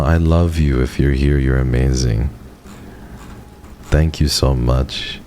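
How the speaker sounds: usual speaking voice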